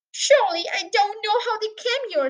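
high, loud, testy retort; snapped. A narrator's reading. Mister Hazel rebuts the father's question, snapping. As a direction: throw his words back at him sharply. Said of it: "snapped Mister Hazel".

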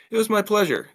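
put-on boy's line in a high girl's voice; high-pitched female character voice; wrong character voice. A narrator's reading. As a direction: pitch it right up, as the girlfriend. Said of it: "I got my voices mixed up".

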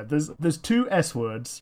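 ordinary talk

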